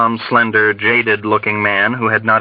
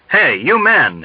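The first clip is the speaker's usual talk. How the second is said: angry roar; 6 semitones higher, slower than usual. A narrator's reading. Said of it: "he roared angrily".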